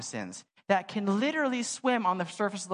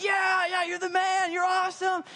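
excited cheering; 11 semitones higher than usual. Retold or imitated. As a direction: cheer him on like a hero. Team cheers for Smalls.

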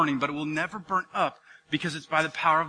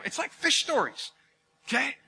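ordinary talk, nothing marked

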